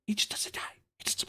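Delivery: exasperated whisper. Real, not acted. Player muttering at an unkillable boss, whispered. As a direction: breathe it out, no voice. Frustrated aside.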